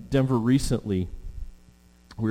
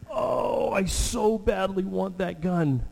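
put-on much higher, slow pleading cry of a boy; longing little boy; 9 semitones higher, slower than usual. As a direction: wail it like a kid desperate for a toy. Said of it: "what is his little heart doing".

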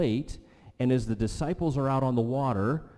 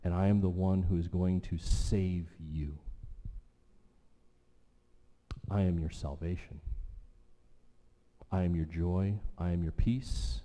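soft, low, whispered then fading lower; hushed promises; 5 semitones lower, 5 dB quieter than usual. Acted. God promising the listeners salvation and peace, in a hushed, fading voice. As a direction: lay each promise down quietly. Pastor voicing God's promises.

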